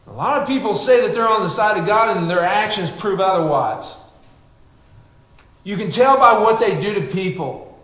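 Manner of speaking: fervent sermon delivery; preaching. Acted. Pastor preaching to his church, fervent. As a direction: preach it to the congregation with fire.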